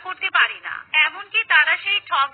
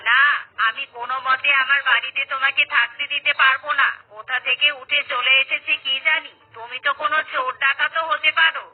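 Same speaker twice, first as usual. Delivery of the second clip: lower, suspicious neighbour shooing a stranger off; gruff refusal from a lower voice; hostile villager; 4 semitones lower than usual. Acted. Villager refuses shelter to the soaked stranger, lower and hostile.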